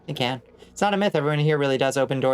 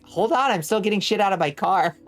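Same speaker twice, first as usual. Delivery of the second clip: higher-pitched mock-exasperated protest; flustered calling out; 7 semitones higher than usual. Imagined reply to an over-eager door-holder, flustered protest. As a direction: call out in comic fluster.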